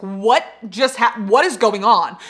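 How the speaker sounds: retold shock. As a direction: replay the stunned confusion. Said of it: "I was genuinely shocked".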